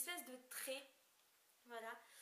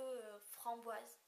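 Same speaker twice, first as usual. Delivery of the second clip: usual speaking voice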